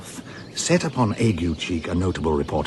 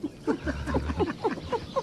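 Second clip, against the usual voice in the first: breathless high laugh; 7 dB quieter than usual. Acted. Toby bursts out laughing.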